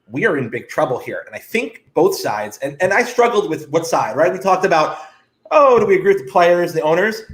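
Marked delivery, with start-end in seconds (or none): none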